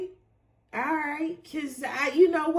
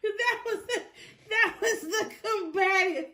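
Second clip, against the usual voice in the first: high, squeezed words through laughter; real helpless giggling under the words; laughing; 6 semitones higher than usual. Real, not acted. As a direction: try to explain while cracking up. Helpless laughter at own impression.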